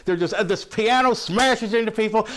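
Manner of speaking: usual speaking voice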